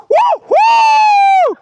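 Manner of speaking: loud high woohoo; excited shout. Real, not acted. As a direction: shout with pure joy. Joyful shout on the road.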